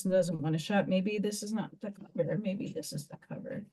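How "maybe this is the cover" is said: fast whisper to herself; a quick whispered guess while crafting. Real, not acted. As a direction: whisper it under your breath, quickly.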